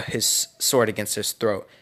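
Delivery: ordinary delivery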